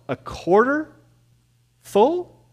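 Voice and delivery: normal talking voice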